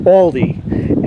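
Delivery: "Aldi" said as ALL-dee; "Aldi" starting like "all"; preferred pronunciation. Shown as a demonstration. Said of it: "as far as I'm concerned".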